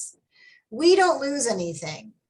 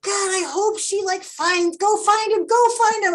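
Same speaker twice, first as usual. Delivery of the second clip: high, urgent plea building to a yell; retold yelling; 10 semitones higher, 5 dB louder than usual. Reader urging author to find birth father, yelling. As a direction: start pleading high, build to loud urging. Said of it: "I'm yelling at the book".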